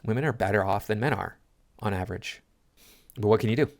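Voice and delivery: usual speaking voice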